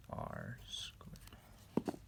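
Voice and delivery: ordinary talk, nothing marked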